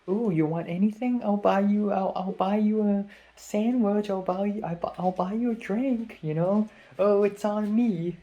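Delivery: creepily nice. Retold or imitated. Fan offering to buy the YouTuber food, creepily nice. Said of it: "because of how nice it sounds".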